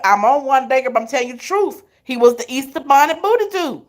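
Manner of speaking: usual speaking voice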